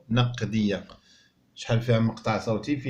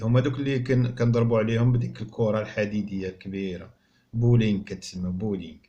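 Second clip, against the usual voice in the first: usual speaking voice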